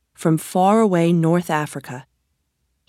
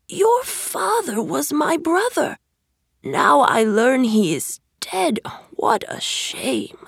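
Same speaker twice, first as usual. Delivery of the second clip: high, slow, then whispered; sly stranger, fading to a whisper; 8 semitones higher, slower than usual. A narrator's reading. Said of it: "he cried".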